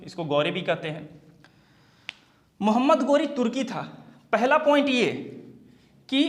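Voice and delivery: usual speaking voice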